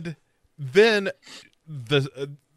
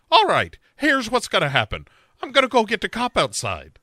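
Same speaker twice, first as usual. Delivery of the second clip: high, louder no-nonsense phone threat; female Liam Neeson; 9 semitones higher, 4 dB louder than usual. Retold or imitated. [name] warning a prank caller, high tough-heroine voice. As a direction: lay down the law in a raised voice. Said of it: "You are doing the female Liam Neeson".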